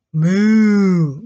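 long, drawn-out cow moo; imitated moo, held long. Shown as a demonstration. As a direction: moo like a cow, teaching children. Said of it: "what does a cow say".

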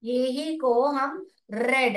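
रेड said slowly with a short e sound; short e read. Shown as a demonstration. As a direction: stretch out रेड with the short e as in red. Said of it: "तब यही spelling को हम रेड करके पढ़ते हैं". (रेड को छोटी ए ध्वनि के साथ धीरे बोलें)

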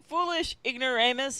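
ordinary talk, nothing marked

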